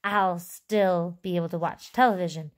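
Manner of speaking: ordinary delivery, nothing marked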